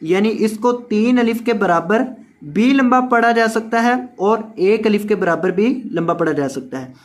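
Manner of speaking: ordinary talk, nothing marked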